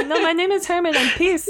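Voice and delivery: high, hammed-up German accent; bad fake German accent. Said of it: "There's our German accent".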